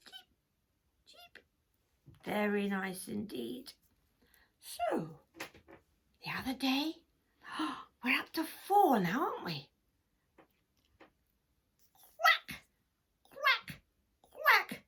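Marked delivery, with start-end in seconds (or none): 0.1-1.4 tiny high cheeps; chick cheep. Acted. 12.2-14.8 high loud quacks; loud hen call. Acted. Hen calling loudly.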